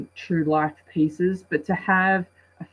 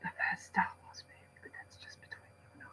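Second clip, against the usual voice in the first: secretive whisper to the viewers; 17 dB quieter, faster than usual. Real, not acted. Vlogger confiding a secret to her viewers, whispering.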